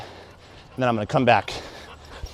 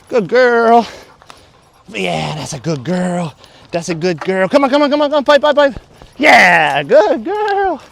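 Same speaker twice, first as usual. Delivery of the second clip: excited dog praise; 9 semitones higher, 7 dB louder, slower than usual. Real, not acted.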